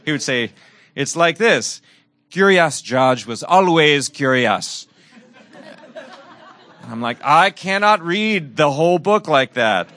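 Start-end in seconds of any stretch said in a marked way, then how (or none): 1.0-4.9 put-on Jamaican patois accent. Man imitating a boy's Curious George reading, Jamaican patois. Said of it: "with a Jamaican patois accent". 7.2-9.9 loud, higher-pitched mock protest. Man telling the boy he can't keep it up, mock protest.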